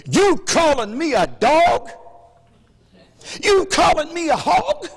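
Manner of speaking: high, loud indignant voice; offended man. Retold or imitated.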